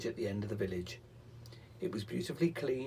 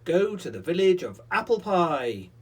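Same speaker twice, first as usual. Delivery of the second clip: louder, high commanding call; loud cry; 6 semitones higher, 11 dB louder than usual. Acted. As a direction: call the chair off loudly. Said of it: "the pixie cried out loudly".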